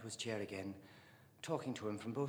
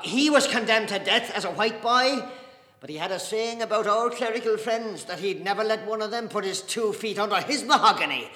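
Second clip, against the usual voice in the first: loud, raised-pitch argument; impassioned declaiming; 8 semitones higher, 13 dB louder than usual. Acted. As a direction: hold forth forcefully about a forebear. Dedalus declaims about his grandfather proudly.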